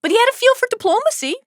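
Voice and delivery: high, fast teasing voice; mock high sing-song quip. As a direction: pitch it up in playful mockery. Mock high quip about Jace.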